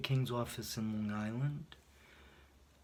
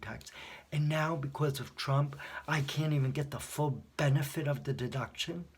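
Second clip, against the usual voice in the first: louder, heated, pressing every point; replaying the fight with the tax clerk, getting louder; retold angry rant; 3 semitones higher, 6 dB louder than usual. Caller arguing with tax hotline volunteer, angry raised voice. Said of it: "this lady got me so angry".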